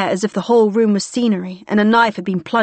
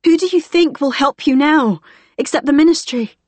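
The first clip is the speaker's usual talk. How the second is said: loud, high, frustrated demand; exasperated shout; 6 semitones higher, 3 dB louder than usual. Acted. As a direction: keep pressing her hard.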